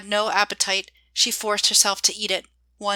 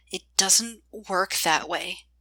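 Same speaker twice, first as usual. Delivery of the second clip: slow, tight words pushed through gritted teeth; through clenched teeth; slower than usual. A narrator's reading. Joy to August, refusing his plan, through clenched teeth. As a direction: hold the anger back behind clenched teeth. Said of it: "she said through clenched teeth".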